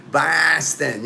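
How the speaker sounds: mock Boston accent with a broad, stretched a; Boston accent, long flattened first vowel. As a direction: imitate a Bostonian saying the city's name. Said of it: "they talk like that".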